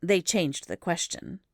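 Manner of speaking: plain reading voice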